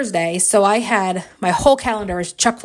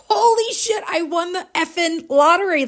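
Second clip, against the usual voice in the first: high-pitched, stunned winner's exclamation; retold exhilaration; 8 semitones higher than usual. Winner reacting to the lottery, high thrilled disbelief. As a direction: squeak it out, reliving the thrill.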